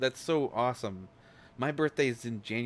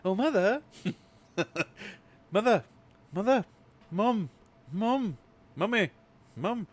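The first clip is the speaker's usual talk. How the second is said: high, loud, posh whiny calls; Stewie voice; 9 semitones higher, 6 dB louder than usual. Acted. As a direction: call for mother like a bratty posh baby. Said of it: "My preppy voice reminds me of Stewie".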